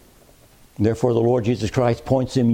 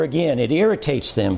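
usual speaking voice

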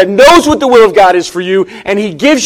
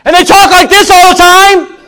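much louder and higher, booming like a loud restaurant talker; loud person impression; 9 semitones higher, 7 dB louder than usual. Shown as a demonstration. Pastor mimics a loud diner to the church, booming loud. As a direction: blast it out like someone who loves to hear themselves talk. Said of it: "You ever been around a loud person?".

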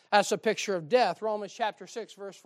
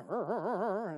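engine sputter; slower than usual. Retold or imitated. Car engine failing to start.

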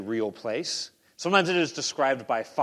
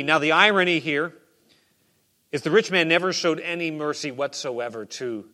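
ordinary talk

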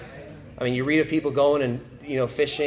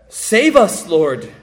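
loud, high plea for rescue; desperate cry; 6 semitones higher, 9 dB louder, slower than usual. Acted. Disciples crying to their Lord in the storm, desperate loud plea. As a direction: cry out to be saved from drowning. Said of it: "you can hear the desperation in their voice".

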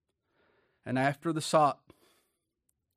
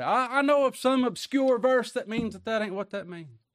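put-on stubborn old man; 7 semitones higher, 4 dB louder than usual. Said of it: "that old man's gonna kick against the pricks".

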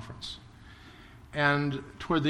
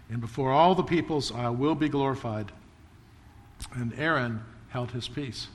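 scripture reading; 4 dB louder than usual. Acted. Reading scripture quote aloud.

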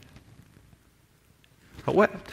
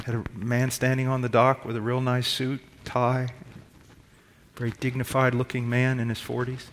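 normal talking voice

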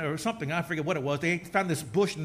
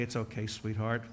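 much softer, lower soothing reply to the wife; tender forgiveness; 9 semitones lower, 5 dB quieter than usual. Retold or imitated. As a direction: gently reassure her it's fine. Husband forgiving wife, voiced.

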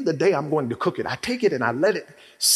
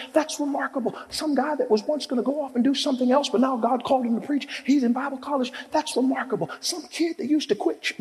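high, driving preacher's cadence; fiery pulpit call and response; preaching at full force; 12 semitones higher than usual. Acted. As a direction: build each line to a hammered that's remarkable.

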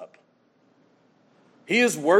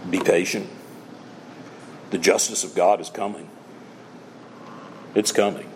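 hushed solemn preaching; 9 semitones lower than usual. Acted. Preacher consoling believers, low and grave. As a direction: let each short line sink in.

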